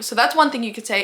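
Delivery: normal talking voice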